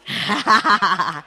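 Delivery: short burst of laughter; laughing. Real, not acted. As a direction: let out a quick laugh. Host laughs at the contestants.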